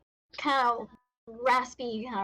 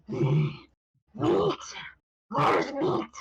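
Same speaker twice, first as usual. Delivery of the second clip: a dim beast sniffing for food, jumpy pitch; curious monster voice; 3 dB louder, much slower than usual. Acted. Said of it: "cocks its head and goes".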